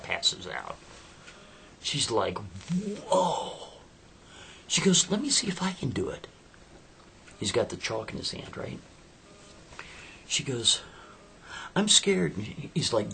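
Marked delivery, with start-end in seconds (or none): 2.4-3.7 amazed whoa. Acted. Amazed at disappearing hand. 5.1-6.3 eager whisper. Acted. 11.8-12.3 scared. Acted. She fears the circle.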